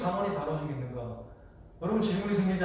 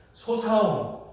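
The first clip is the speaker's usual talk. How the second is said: "소사움" with lax s replacing the tense ss; Gyeongsang pronunciation; much slower than usual. Shown as a demonstration. Preacher shows Gyeongsang speakers saying bullfight, Gyeongsang lax s. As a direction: Gyeongsang accent, softened s in "소사움". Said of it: "이렇게 되요".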